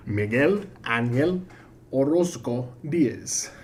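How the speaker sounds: slow, drawn-out name in a put-on Latin American accent; exaggerated Latin American accent. Streamer repeats a viewer's full name as a bit, mock Latin American accent. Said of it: "just say the full name over and over".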